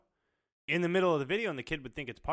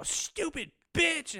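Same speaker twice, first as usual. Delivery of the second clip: very high, slow, stretched yell; wife raging at game; 5 dB louder, much slower than usual. Retold or imitated. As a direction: rage at the game like a gamer wife. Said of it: "She'll go".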